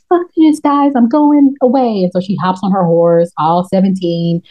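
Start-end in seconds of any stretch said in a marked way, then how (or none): 0.1-2.0 mock exasperated princess. Princess quitting the party, mock exasperated. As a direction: storm off with a huff. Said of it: "she's like".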